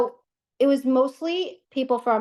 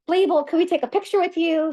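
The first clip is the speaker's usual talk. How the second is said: higher, louder eager ask; excited request; 8 semitones higher, 4 dB louder than usual. Retold or imitated. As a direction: ask like a thrilled fan meeting a star. Asking a celebrity rabbi for photo.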